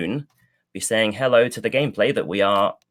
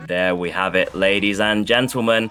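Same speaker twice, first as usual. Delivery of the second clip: triumphant call; 5 dB louder than usual. Real, not acted. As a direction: shout out the final wipe. Caster calls the decisive wipe.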